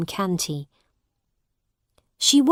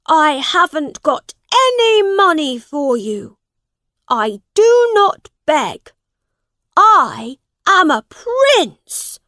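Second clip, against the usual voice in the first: high, loud, defiant outburst; indignant prince; 8 semitones higher, 8 dB louder than usual. A narrator's reading. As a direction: refuse proudly at full, high voice. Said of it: "replied Edward".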